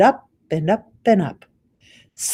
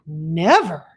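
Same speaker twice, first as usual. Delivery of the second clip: passionate cry; 3 dB louder than usual. Acted. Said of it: "cried the B F G passionately".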